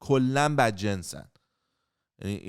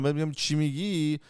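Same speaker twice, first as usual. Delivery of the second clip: ordinary talk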